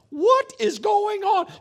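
slow, high, loud astonished cry; stunned parent; amazed. Retold or imitated. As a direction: gasp it out in disbelief. Amazed at child reading sign.

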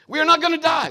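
loud, high, insistent; calming a panicking passenger, firmly; firm declaration. Retold or imitated. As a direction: stress NOT to convince him.

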